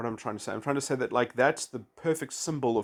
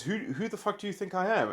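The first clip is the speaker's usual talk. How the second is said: higher, fast indignant retort, played for the joke; mock outraged woman; 8 semitones higher, faster than usual. Woman in the joke snapping back at the man, mock outrage.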